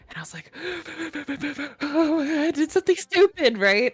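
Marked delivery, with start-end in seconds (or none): 0.6-1.8 mock hyperventilating, high quick gasps; panicked gasping. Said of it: "called my mom freaking out". 1.8-3.6 high, loud panicked confession; freaking out. Retold or imitated. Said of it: "called my mom freaking out".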